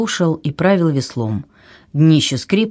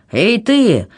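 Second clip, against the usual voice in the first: raised call to the shore; 6 semitones higher than usual. A narrator's reading. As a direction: hail someone far away. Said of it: "закричал ярл на берег".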